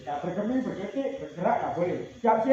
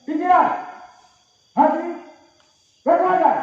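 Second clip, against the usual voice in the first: loud high command calls; game leader calls out the commands; called commands; 6 semitones higher, 5 dB louder than usual. Real, not acted. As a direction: bark each command to the group.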